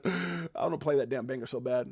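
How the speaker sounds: ordinary talk, nothing marked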